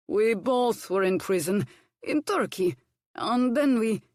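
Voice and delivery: a hint of a gruff foreign cook; bossy kitchen instructions, lightly accented. A narrator's reading. Hercules instructing Violet at potatoes, lightly accented. Cook's tale.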